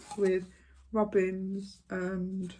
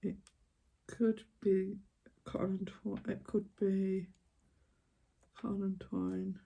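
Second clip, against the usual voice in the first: muttering to herself; 8 dB quieter than usual. Real, not acted.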